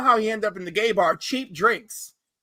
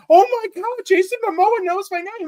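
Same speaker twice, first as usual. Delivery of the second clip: much higher, louder starstruck gush; starstruck squeal; 9 semitones higher, 6 dB louder than usual. Retold or imitated. Scotty meeting Jason Momoa, starstruck and much higher. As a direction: squeal like a thrilled fanboy. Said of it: "And he's like".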